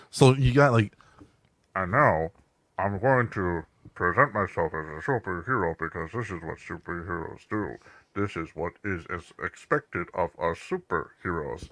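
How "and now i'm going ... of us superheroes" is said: low, muffled superhero croak; mock a superhero with a frog in his throat; frog-voiced superhero narrator. Mocking the audio drama's superhero narrator, low croaking voice. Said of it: "he's talking like this".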